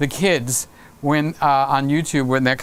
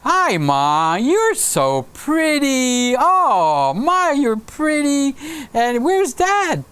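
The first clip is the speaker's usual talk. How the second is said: put-on groggy kid; 7 semitones higher, slower than usual. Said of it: "so he's going like".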